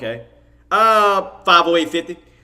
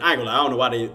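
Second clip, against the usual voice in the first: ordinary delivery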